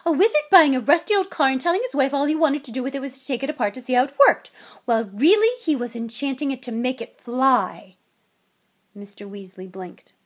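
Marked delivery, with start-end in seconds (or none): 0.1-8.0 loud, fast, higher tirade; furious wife in full flow; angry scolding. A narrator's reading. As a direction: rattle off the accusation heatedly. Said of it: "her eyes flashing".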